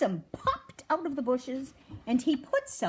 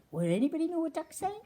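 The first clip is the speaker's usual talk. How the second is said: usual speaking voice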